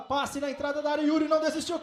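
ordinary delivery

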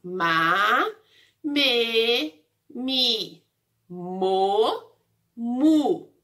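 "Ma, me, mi, mo, mu" as separate drawn-out syllables; syllable drill. Shown as a demonstration. Teacher reciting the syllables of letter M, "Ma, me, mi, mo, mu" one by one. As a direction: stretch and separate "Ma, me, mi, mo, mu". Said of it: "Essas são as sílabas da letra M".

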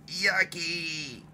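high, soft disgusted yucky; a high playful yuck. Acted. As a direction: pull a face at the taste. Bird tastes bad ladybug.

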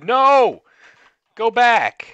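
loud high yell; panicked shout. Real, not acted.

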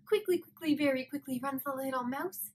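bright playful rush; quick mouse singsong; lively mouse verse. Shown as a demonstration. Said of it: "let's try it again".

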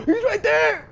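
panicked high shout; startled shout. Real, not acted. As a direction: gasp then a high, loud yell. Spots the monster.

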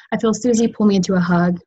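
usual speaking voice

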